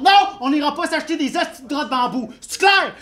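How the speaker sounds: high, exasperated acted yell; shouted retort. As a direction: shout back to shut the voice up. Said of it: "je réponds à voix haute".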